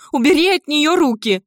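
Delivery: high, loud, furious order; a mother shouting to protect her child; screaming. Acted. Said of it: "закричала Мара". (яростный крик)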